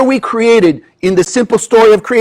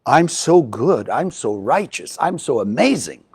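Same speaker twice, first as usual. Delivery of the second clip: mock smug ego; 7 semitones lower, 8 dB quieter than usual. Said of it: "Can't be my fault".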